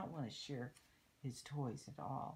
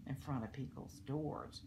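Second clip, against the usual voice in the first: ordinary delivery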